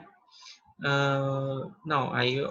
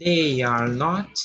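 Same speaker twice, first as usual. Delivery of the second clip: slow model reading; 5 dB louder, much slower than usual. Shown as a demonstration. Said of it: "Com they".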